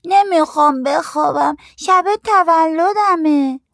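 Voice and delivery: put-on very high, slow, drawn-out kid voice; little kid stretching out every word in protest; protesting small child. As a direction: refuse bedtime in a loud, squeaky child's voice. Child refusing to go to sleep.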